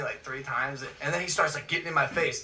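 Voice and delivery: normal talking voice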